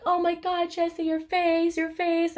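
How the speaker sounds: high, drawn-out wail; imitated crying mom. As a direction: mimic a mother crying in horror. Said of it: "my mom just started crying".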